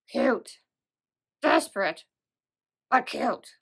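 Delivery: punchy one-word verdicts in a put-on character voice; Donka. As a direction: drop each word separately in an impersonated game character's voice. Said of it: "This is my best Donka impression".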